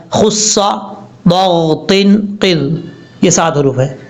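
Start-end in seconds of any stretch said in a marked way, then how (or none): 0.1-1.9 خص ضاغط drawn out very slowly and full-mouthed; teacher reciting the memory phrase slowly; slow heavy recitation. Shown as a demonstration. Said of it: "ان کا مجموعہ یاد کرنا چاہتے ہیں".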